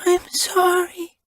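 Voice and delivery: high, slow whimpered apology; tearful little whimper. A narrator's reading. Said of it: "she whimpered".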